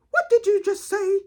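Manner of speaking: high, loud challenge from a proper aunt; Aunt Ada. Retold or imitated. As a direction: imitate a strict aunt pouncing on bad diction. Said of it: "That's just how she talks".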